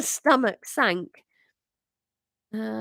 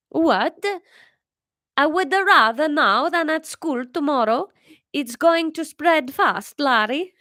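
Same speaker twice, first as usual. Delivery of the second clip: higher, louder defiant mother; 6 semitones higher, 4 dB louder than usual. Acted. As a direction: stand your ground, voice up. Mother defends her choice.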